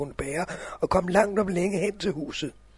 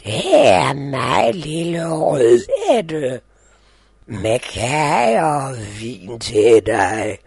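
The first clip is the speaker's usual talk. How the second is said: put-on loud, slow wolf posing as a girl; wolf faking a girl; 3 semitones lower, 10 dB louder, much slower than usual. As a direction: drawl it like a wolf pretending to be a sweet girl. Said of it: "svarede ulven".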